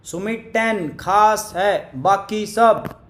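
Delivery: very slow, each word drawn out so it can be typed; slow, deliberate dictation of "Sumit ten खास है, बाकी सब". Shown as a demonstration. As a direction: spell it out slowly for the chat. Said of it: "जल्दी फटाफट से".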